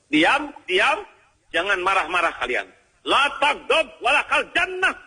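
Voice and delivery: high, heated telling-off; mock angry scolding. Hot-tempered person telling others not to be angry, in high mock fury. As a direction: play someone angrily preaching against anger. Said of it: "Orang yang marah-marah tuh".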